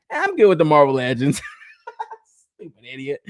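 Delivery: chuckling imitation of his own shrug; laughing self-mockery. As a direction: poke fun at past self. Mocking his past self, laughing.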